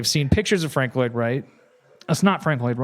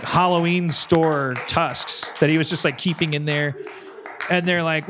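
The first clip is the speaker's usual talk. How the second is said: normal talking voice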